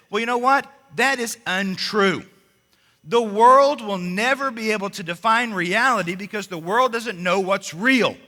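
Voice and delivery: loud, emphatic preaching with falling ends; full-force preaching. Acted. Preacher to congregation, loud emphatic preaching. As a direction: drive each claim home from the pulpit.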